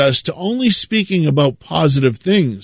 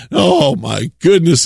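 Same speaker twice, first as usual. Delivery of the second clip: ordinary delivery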